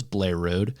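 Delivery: "Blair Road" squeezed into one syllable, Baltimore style; Baltimore pronunciation. Retold or imitated. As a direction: say "Blair Road" the way a Baltimore local says Bel Air Road. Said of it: "uh, pronounces, uh, Bel Air Road to him".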